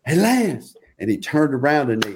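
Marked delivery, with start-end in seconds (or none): 0.0-0.7 retold sideline yell, higher. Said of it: "And I yelled".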